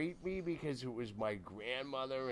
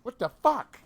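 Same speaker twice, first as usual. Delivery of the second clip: high, loud disbelief; pitched-up mock outrage; retold shocked sister; 11 semitones higher, 11 dB louder than usual. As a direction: exclaim as an imitated shocked kid. Voicing shocked little sister.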